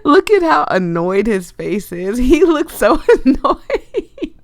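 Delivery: loud, high giggling through the words; laughing. Acted. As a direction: laugh at his annoyed face. Laughing at annoyed man.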